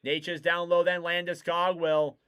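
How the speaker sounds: ordinary delivery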